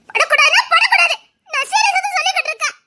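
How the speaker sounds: high tearful shout. Acted. Wife shouting at her husband not to touch her, high and tearful. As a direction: yell it through half-laughing tears. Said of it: "கத்தாத".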